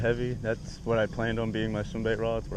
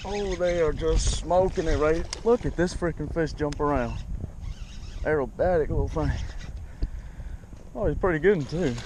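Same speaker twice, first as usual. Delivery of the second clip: excited; 5 semitones higher than usual. Real, not acted. Fish jumping, excited.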